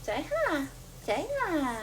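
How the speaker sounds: high coaxing sing-song. Real, not acted. Mom urging her little one to greet viewers, high baby talk. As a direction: gently urge the little one to say hi.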